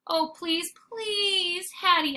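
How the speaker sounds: high, rising plea; pleading cry. Acted. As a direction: beg her desperately. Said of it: "I cry".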